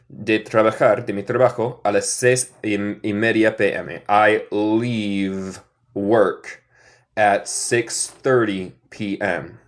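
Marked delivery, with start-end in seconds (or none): 4.1-9.6 very slow, stretched out; slow model sentence. Shown as a demonstration. Teacher modeling an example for the class, very slow. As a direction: stretch every word for learners. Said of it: "Note mi acento".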